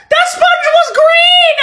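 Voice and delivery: high, slow crying-out shout; mock anguished yell; shocked shout. Real, not acted. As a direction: wail it in disbelief. Shock at sponge.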